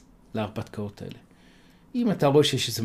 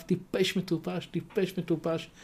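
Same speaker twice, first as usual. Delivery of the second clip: mocking taunt; 4 semitones higher, 6 dB quieter, slower than usual. A narrator's reading. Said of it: "צוחקת עליו".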